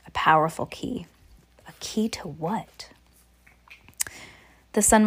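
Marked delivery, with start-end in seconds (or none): 0.1-2.9 breathy eerie whisper. Acted. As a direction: hush it like a secret.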